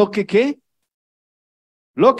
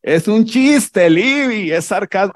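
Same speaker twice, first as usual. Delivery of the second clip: Claudita. Retold or imitated. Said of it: "Ella es como".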